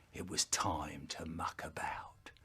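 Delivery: soft mischievous whisper. Acted.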